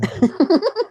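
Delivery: squealing laugh; short high-pitched laugh. Real, not acted. Laugh after joke.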